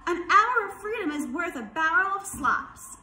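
high, loud goose proclamation; honking goose. A narrator's reading. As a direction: honk it out insistently. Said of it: "honked the goose".

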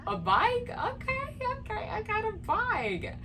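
high, forced okay okay, pretending to be pleased; mock fake gratitude. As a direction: swallow the letdown and act happy. Reenacts her reaction to bike gift.

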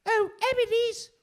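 put-on very high-pitched old England voice; old English accent. Shown as a demonstration. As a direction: squeak it out in a hammed-up English accent. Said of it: "just in bloody old England. Probably my best accent is".

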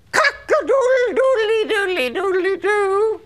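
high mock rooster crowing. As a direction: crow like a silly rooster. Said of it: "Does a wolf sound like this?".

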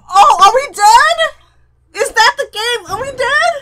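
high, loud alarmed questions; panicked asking. Real, not acted. Player fearing game over, loud high alarm. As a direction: cry out in worried disbelief. Thinks party died in game.

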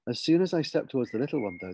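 plain reading voice

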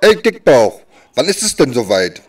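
ordinary talk, nothing marked

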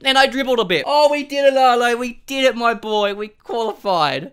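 high, loud victory babble; elated celebrating. Real, not acted. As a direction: crow about the win. Celebrates qualifying.

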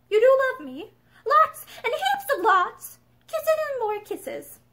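high, slow tender cooing; tender bedtime talk. A narrator's reading. Mother cooing goodnight to her son, tender. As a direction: coo it like to a little boy.

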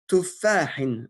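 slow, deliberate dictation of Arabic words; careful teacher dictation with pauses between words. Shown as a demonstration. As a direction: dictate each word slowly so students can write it. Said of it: "l'autodictée que je vous lis".

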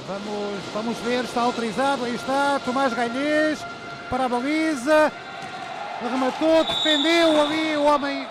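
rising excited match call; live play-by-play. Real, not acted. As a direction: call the attack as it unfolds.

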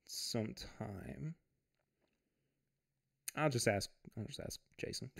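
ordinary delivery

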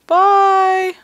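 high, loud drawn-out call; playful shouted farewell. Pretend. Player calling goodbye in the game, high playful shout.

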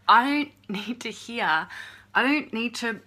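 loud, furious rant at the trolls; angry at trolls. Real, not acted. To the trolls watching the channel, a loud angry rant. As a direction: yell it at the trolls, fed up.

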